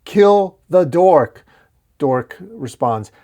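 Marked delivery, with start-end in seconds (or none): 0.0-1.4 slow, high, loud chant-like war cry; yelling. A narrator's reading. Orcs and knights turning on the dork, yelling. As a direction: shout each word apart like a mob. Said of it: "yell in unison".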